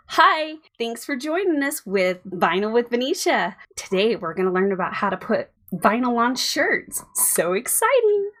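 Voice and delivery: sing-song tutorial hostess; cooking-show voice. Pretend. Wife hosting her pretend vinyl show, perky presenter voice. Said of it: "her Cooking with Venetia voice".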